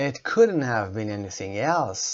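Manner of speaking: normal talking voice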